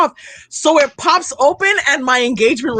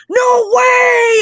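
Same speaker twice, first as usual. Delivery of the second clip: high, drawn-out excited cry; 9 semitones higher, 4 dB louder than usual. Retold or imitated. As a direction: act out a thrilled friend's disbelief. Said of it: "he's all, like, excited".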